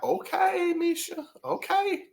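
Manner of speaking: high-pitched, drawn-out okay; impressed reaction. Retold or imitated. Vlogger reacting to Misha's rave review, impressed and high-pitched.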